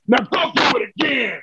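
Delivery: high, insistent command; acted order. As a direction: order him back up, voice pitched high. Ron repeating his order.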